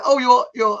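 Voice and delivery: put-on Zimbabwean accent. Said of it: "something about his accent".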